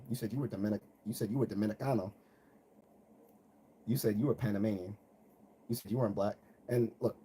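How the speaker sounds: ordinary talk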